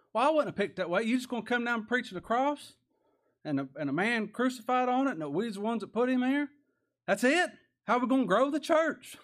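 high, loud voice of a scoffing doubter; mock the plan with loud, high-pitched questions; incredulous mock objection. Voicing doubters of God's plan.

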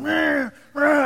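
high mock buzzing of clippers; clipper buzz noise. Husband shaving his own head, mock buzz. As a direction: make the clipper sound.

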